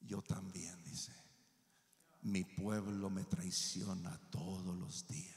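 low, slow whisper of God; God answering the preacher in a low whisper; voice of the Lord. Retold or imitated. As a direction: speak as God, hushed and grave. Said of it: "llega la voz del Señor".

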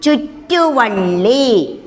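ചുറ്റുവള്ളി stretched slowly; slow drawn-out. Shown as a demonstration. Teacher naming the sign slowly for children. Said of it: "നമ്മൾ എന്താ പറയുക?".

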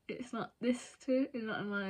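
talking through bared teeth; finger on teeth. Real, not acted. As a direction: lips pulled back smiling. Seen 1: Fingertip pressed on front teeth, lips drawn back, while speaking.

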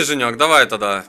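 ordinary delivery

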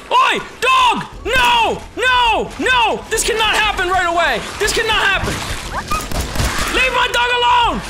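high, loud frantic yells; panicked shouting. Real, not acted. Player yelling as the dog is attacked, panicked. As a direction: scream to protect the dog.

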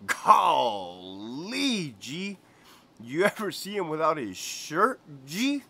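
dreamy, rising on every G; Greñas voice. Retold or imitated. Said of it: "This is how the whole conversation went".

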